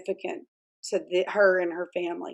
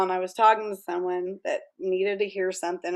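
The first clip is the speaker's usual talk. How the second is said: ordinary talk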